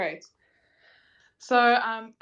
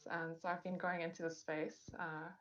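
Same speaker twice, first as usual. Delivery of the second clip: muffled; 2 semitones lower, 11 dB quieter than usual. Real, not acted.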